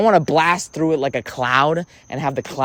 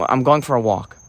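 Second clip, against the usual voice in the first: low, unenthused statement; joyless, dropped-pitch delivery; not enjoying it; 4 semitones lower than usual. Shown as a demonstration. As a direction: say it low, wanting out. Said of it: "that I'm not enjoying".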